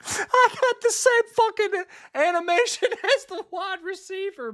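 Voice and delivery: high, loud, laughing through the words. Real, not acted. Streamer laughing at his player's animation, laughing through it. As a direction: crack up at the replay.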